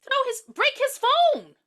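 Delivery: loud, slow, high exasperated order. Real, not acted. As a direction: yell it at the screen. Frustrated with character.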